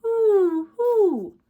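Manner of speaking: high, loud wind howl. Acted. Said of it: "The wind blew".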